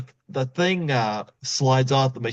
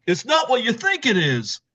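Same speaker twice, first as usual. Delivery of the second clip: caught husband; 10 semitones higher than usual. Retold or imitated.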